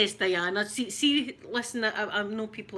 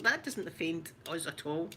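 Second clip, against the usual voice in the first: ordinary delivery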